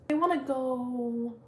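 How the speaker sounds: chuckling as she restarts; a giggle through the words; laughing. Real, not acted.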